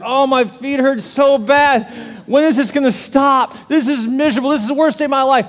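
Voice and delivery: hammed-up wail of a miserable hiker; mock whining complaint. Husband imitating himself griping on the family hike, mock whining. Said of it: "this is all you hear".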